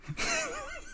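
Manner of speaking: very high, soft giggle; stifled giggle. Real, not acted. Host after his impression, soft high giggle. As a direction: giggle at your own silly voice.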